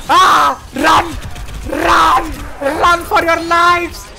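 panicked shouts to run; yelling while running away. Real, not acted. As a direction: yell at everyone to flee. Fleeing enemies in game.

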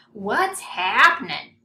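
booming hearty hello; loud greeting. A narrator's reading. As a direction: bellow it like a big friendly oaf. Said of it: "and a loud".

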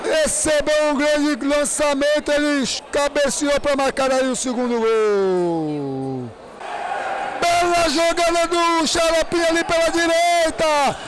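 fast, excited match commentary; play-by-play commentary. Real, not acted. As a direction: call the game live. Live futsal play-by-play.

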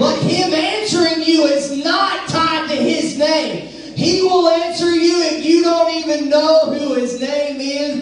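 high, slow, emphatic proclamation; preaching. Real, not acted. Preacher teaching congregation about the name, preaching. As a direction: proclaim it slowly from the pulpit.

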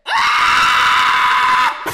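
high, drawn-out scream; long scream. Real, not acted. Player panicking in game, long high scream. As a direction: scream and hold it.

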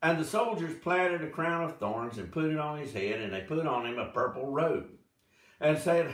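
ordinary talk

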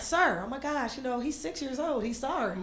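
soft, placating explanation; meek apology. Retold or imitated. Guardian excuses a six-year-old to an irate man, soft apology. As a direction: back down quietly and apologize. Apologizing for his little cousin.

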